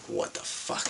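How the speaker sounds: whispered amazement; surprised whisper. Retold or imitated. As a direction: whisper it in disbelief. Surprised she takes his number.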